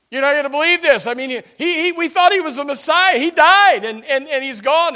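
high, loud, breaking cry of grief; anguished wail. Acted. Grieving disciple cries out to his friend on the road. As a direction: wail it out like a mourner who lost everything. Disciples voiced, distraught over death.

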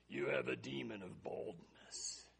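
slow, spooky stage whisper; mock-ominous whisper. Preacher mocking Christians who blame everything on demons, slow mock-ominous whisper. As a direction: whisper it slowly like a grave diagnosis.

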